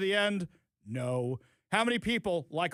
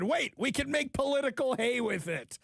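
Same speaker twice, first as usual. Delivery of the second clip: higher-pitched, hammed-up sudden excitement; mock eager realization; 6 semitones higher than usual. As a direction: pounce on it like a mock bright idea. Mocks political opportunists seizing on a video.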